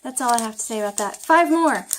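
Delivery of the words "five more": "Five more" called out loud and high; cheery announcement. Acted. Student cheering herself on before the last cards. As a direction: hype yourself up for the last five.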